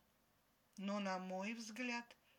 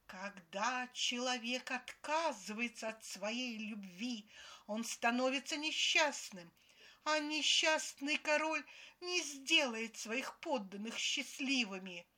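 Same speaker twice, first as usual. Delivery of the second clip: loud, higher-pitched preachy scolding; angry cartoon fairy; 3 semitones higher, 7 dB louder than usual. A narrator's reading. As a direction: lecture like a cross Disney fairy. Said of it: "тоном рассерженной феи из диснеевского мультика начала проповедовать".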